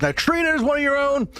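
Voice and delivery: a theatrical host voice, slowing down on the final warning; Victor's showman voice. Acted. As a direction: play the slick director presenting his stars. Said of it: "Victor kind of looks over and goes".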